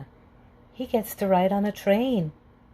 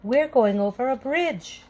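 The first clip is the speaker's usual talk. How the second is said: excited; 5 semitones higher than usual. A narrator's reading. Pete excited on the bridge.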